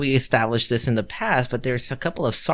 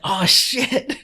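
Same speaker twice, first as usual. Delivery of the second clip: high-pitched mock panic; retold sudden oh-no moment; alarmed realization; 12 semitones higher than usual. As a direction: shoot up high in comic alarm. Woken Jimmy learns sergeants are coming.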